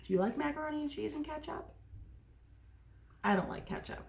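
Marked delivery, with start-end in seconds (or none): none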